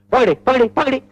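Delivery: high, repeated scolding order; rapid-fire angry calls; angry summons. Acted. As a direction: order them over in a temper. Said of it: "இது நிஜமான கோபமா".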